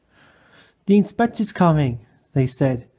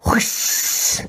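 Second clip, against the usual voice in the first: urgent hushing whisper; voiceless, hoarse shushing. A narrator's reading. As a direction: hush them in a hoarse whisper. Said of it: "whispered Donald hoarsely".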